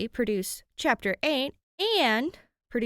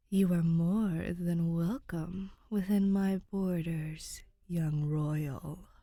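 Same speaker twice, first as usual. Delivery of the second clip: 1 fond ghostly voice; much slower than usual. Acted. Ghost welcomes with fondness.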